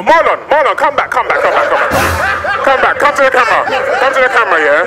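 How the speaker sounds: loud, high, repeated holler; urgent calling out. Retold or imitated. Calling the departing driver back to camera, urgent shout. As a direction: shout after someone walking off.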